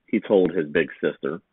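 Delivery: usual speaking voice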